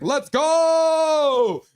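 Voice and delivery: high, loud cheer; cheering chat. Retold or imitated. Streamer voicing a chat message, loud excited cheer. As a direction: shout it like a hyped fan. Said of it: "Paragraph Pro is like".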